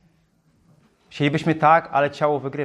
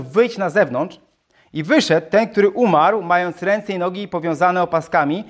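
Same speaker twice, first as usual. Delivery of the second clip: scripture reading; 4 semitones higher than usual. Acted. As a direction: proclaim the Gospel passage to the church. Reads scripture aloud. (podniosłe czytanie Ewangelii)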